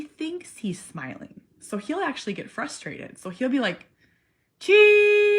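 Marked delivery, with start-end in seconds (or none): 4.6-5.3 high, loud strained "Cheese"; imitating son. Mom imitating her son forcing out the word, strained high. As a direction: push out "Cheese" like a frustrated kid.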